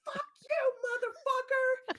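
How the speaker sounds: high, loud cursing shout; defiant shout. Acted. Deirdre flips off the villain, loud high cursing shout. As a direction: scream it up at the speaker. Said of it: "brings up a hand with the middle finger and says".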